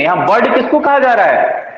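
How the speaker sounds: ordinary talk, nothing marked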